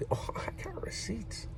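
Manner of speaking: breathy whispered aside. Real, not acted. Teasing viewers with a secret, whispered.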